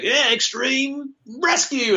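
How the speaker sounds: slow, high hammed-up Australian accent. Retelling his Crash scratch take in Australian accent. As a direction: drawl it out like a cartoon Australian bandicoot. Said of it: "performed the scratch track as an Australian".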